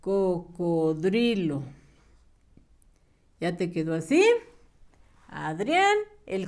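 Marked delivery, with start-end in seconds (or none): 0.0-1.6 "cocodrilo" sílaba por sílaba, muy despacio; dictado lento. Shown as a demonstration. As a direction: alarga cada sílaba para que lo escriban. Maestra dicta palabra lentamente. (dictado pausado de maestra)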